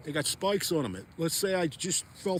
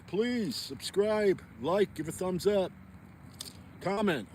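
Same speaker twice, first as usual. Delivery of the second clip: slow pleading request; 3 semitones higher, slower than usual. Real, not acted. Vlogger asking his viewers to subscribe, slow earnest plea. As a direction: draw out please subscribe slowly, earnestly.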